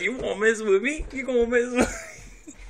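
high, loud playful challenge; mock confrontation. Voicing a so-serious guy for laughs, mock confrontational.

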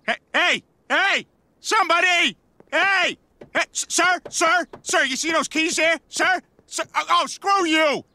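high desperate calling out; calling for help. Acted. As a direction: call out to a passerby.

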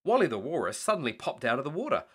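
ordinary delivery

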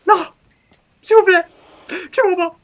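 high, loud panicked plea. Real, not acted. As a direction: shriek for your life. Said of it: "j'ai crié comme une fille".

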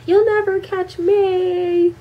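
high, slow teasing taunt; taunting girl. Retold or imitated. Girl running from dad, mock taunting. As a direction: sing it out mockingly.

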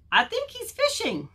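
high-pitched storybook bunny. Acted. Bunny character answers walrus.

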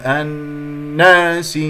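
الناس with a punch after the nasal ن; wrong stress. Shown as a demonstration. Teacher showing the mistake, wrong stress. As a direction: hit the "naa" of الناس after the hum. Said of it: "تنبر، أنت أتيت في الغنة".

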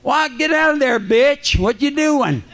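high yelled shout with a laugh at the end; audience screaming at screen. Retold or imitated. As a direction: holler at the movie screen like the crowd. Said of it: "they screamed at the screen".

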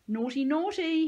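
high, loud, drawn-out mock scolding. A narrator's reading. Peyton wagging a finger at Jake, mock scolding. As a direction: tut at them like naughty children.

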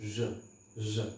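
ज said as a soft voiced zh, low and soft; zh sound. Shown as a demonstration. Teacher demonstrating ज (zh), low and soft. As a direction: breathe ज as the s in vision. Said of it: "तो ये हो जाता है ज".

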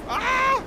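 short, high, loud cry; panicked scream. Real, not acted. Player failing to take off the plane, panicked scream. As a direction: scream as control slips away. Plane goes wrong in game.

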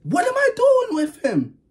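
disbelieving squeal. Real, not acted. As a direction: stretch "doing" out high in real disbelief. Repeating question in disbelief.

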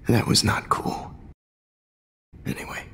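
normal talking voice

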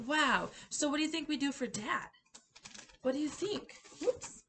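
normal talking voice

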